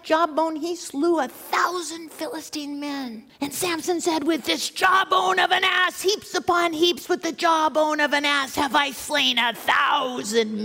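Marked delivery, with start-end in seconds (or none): 4.2-10.6 triumphant shout. Acted. Samson proclaims his victory with the jawbone, shouting. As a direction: boom it out over the slain.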